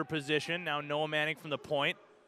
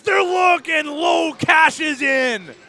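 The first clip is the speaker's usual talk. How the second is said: much higher, much louder goal shout; goal call; 12 semitones higher, 13 dB louder, much slower than usual. Real, not acted. Play-by-play caller shouting as Lowe scores. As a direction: scream the goal.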